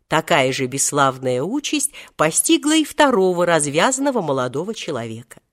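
ordinary delivery, nothing marked

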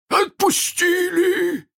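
very high, drawn-out shout; outraged cry. A narrator's reading. Hook cries out at his crew, high outraged shout. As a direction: cry out in disbelief, stretched and high. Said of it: "вскричал Крюк".

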